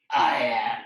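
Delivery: loud, forceful low hero boom; superhero voice. Acted. Bao Man starts announcing himself, loud serious superhero voice. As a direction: declare it boldly, big and heroic. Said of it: "you gotta read it in that voice".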